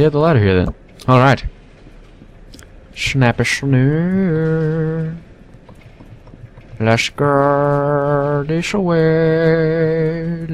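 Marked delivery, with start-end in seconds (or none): 2.9-5.2 slow, stretched silly voice; goofy catchphrase voice. Pretend. 6.8-10.5 very slow, stretched put-on voice; slow silly voice. Drawn-out put-on voice.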